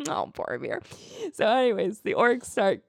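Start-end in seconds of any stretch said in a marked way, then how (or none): 0.1-0.8 sassy. Retold or imitated. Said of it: "it's all sassy".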